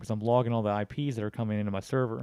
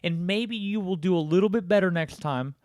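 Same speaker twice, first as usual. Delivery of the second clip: higher, louder condescending tips; sarcastic advice; 6 semitones higher, 4 dB louder than usual. Retold or imitated. Mocking the scammer with advice, sarcastic condescension. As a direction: lecture him like a failing pupil. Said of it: "I was like".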